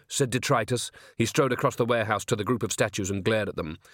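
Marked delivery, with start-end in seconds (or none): none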